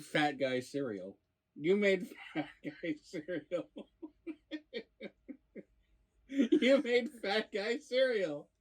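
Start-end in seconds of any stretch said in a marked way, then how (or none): 1.5-8.5 high, laughing and slow. Real, not acted. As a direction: laugh through the words. Laughing at Fat Guy cereal.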